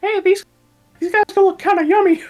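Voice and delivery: high, fast greedy pet voice; hungry owl voice. Acted. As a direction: voice a hungry owl eyeing rats.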